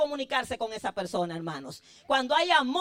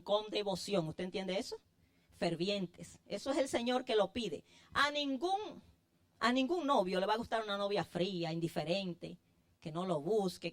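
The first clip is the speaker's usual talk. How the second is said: softer, lower, confiding preacher's voice; intimate sermon; 3 semitones lower, 6 dB quieter than usual. Acted. Preacher confiding to his flock, soft intimate appeal. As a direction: draw them close, speak it tenderly.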